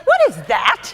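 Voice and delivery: loud, incredulous reenacted question; mock disbelief. Actress teasing her voice double over the recording, mock disbelief. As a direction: relive your appalled reaction, loudly. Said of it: "I was like".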